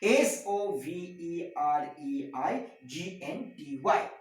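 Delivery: "S O V E R E I G N T Y" spelled out letter by letter, slowly; spelling out a word. Shown as a demonstration. Lecturer spells the key term for students, letter by letter. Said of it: "The sovereignty".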